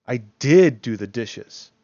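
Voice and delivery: "did" punched loud and drawn out; insist, hitting "did" hard and slow; angry emphasis on did. Shown as a demonstration. Teacher answers someone who said you didn't, "did" stressed angrily. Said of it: "like you're angry".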